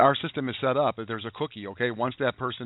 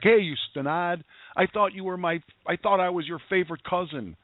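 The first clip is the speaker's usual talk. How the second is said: lightly voiced tough cousin, higher pitched; a hint of a brassy, teasing cousin, pitched up; tough Polish cousin; 6 semitones higher than usual. Cousin teasing him on the phone, lightly voiced tough-woman tone. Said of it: "she's a Polish, tough chick".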